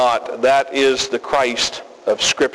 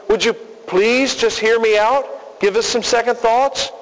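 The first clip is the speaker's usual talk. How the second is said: mock pleading Jesus; 6 semitones higher than usual. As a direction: plead like someone desperate for a hearing. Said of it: "You don't find him saying".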